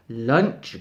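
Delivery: low, loud tiger growl; menacing tiger growl. Acted. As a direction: growl it hungrily. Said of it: "growled Tiger".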